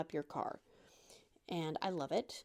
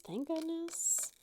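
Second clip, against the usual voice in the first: high, slow, drawn-out relief; slow relieved sigh; 8 semitones higher, slower than usual. Real, not acted. Relieved she didn't mess up, slow and high. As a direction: let the relief stretch out.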